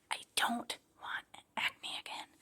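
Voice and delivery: unvoiced whisper, scared; whispered fear. Real, not acted. Afraid of acne coming back, a real whisper. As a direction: breathe it out in dread. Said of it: "But, like".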